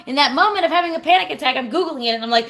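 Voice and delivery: normal talking voice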